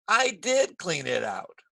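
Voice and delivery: slow, emphatic "did"; stress on DID. Shown as a demonstration. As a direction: correct them by leaning on "did". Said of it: "to emphasize a point or correct a mistake".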